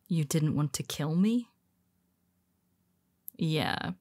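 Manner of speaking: ordinary talk, nothing marked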